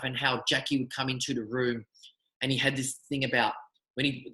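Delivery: ordinary talk, nothing marked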